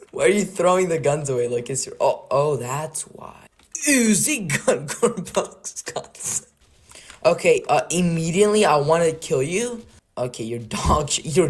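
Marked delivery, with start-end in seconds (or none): 3.9-6.5 slow words through giggles; laughing. Real, not acted. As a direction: read it out while cracking up. Laughing at knockoff names.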